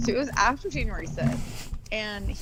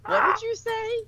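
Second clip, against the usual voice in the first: incredulous; 12 semitones higher than usual. Retold or imitated. Disbelief at the caller's claim.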